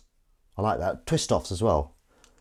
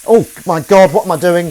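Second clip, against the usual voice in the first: loud, higher alarm at self; exclamation; 8 semitones higher, 14 dB louder than usual. Real, not acted. Realising the theme was forgotten, loud exclamation. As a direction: exclaim at your own mistake.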